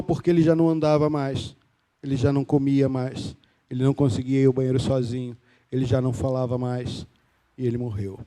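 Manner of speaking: low, subdued voice sinking as it goes; grieving father, hushed; quiet grief. Real, not acted. Father recounts his son's death.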